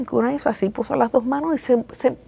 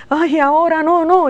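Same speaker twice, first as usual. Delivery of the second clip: mock reluctant whine; 7 semitones higher, 3 dB louder than usual.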